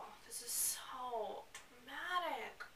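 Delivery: slow, sarcastic whisper; slow mocking whisper; whispered aside. Real, not acted. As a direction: whisper it like a secret jab.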